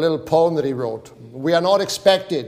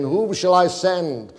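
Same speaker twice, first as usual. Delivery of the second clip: slow, pleading divine voice; the Lord's slow appeal for who will go; 3 semitones higher, slower than usual. Acted. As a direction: call out slowly as God seeking a messenger. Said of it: "heard the voice of the Lord saying".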